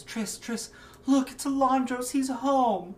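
high, loud excited call. Acted. Mother calls servant Triss, high and excited.

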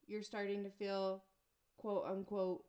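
ordinary talk, nothing marked

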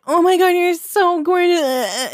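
hammed-up tearful gushing over a dress; mock gushing. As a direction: stretch it out like a weepy fan. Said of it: "it wasn't like".

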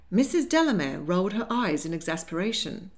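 usual speaking voice

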